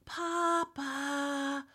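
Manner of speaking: long, drawn-out searching whisper; whisper-call slowly for someone hiding; high, soft, stretched whisper. A narrator's reading. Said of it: "they said, whispering together".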